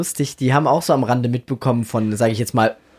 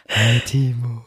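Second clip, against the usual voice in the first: lower, airy mock greeting; imitating breathy hello; 3 semitones lower, much slower than usual. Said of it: "wie sich deine Stimme sofort verändert hat".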